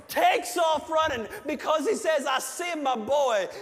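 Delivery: fiery sermon build-up; preaching at full force. Acted. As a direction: preach it out to the whole church. Preaches the prodigal son.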